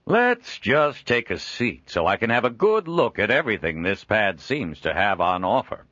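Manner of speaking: a jovial trader chuckling at his own likeness; Joel, amused trader. Acted. Joel supporting Leontine.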